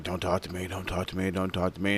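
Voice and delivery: low, hushed, repeated aside; imitated muttered plea. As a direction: mutter it under your breath, hoping to go unnoticed. Chavo avoiding eye contact, looking around.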